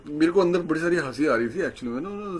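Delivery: ordinary talk, nothing marked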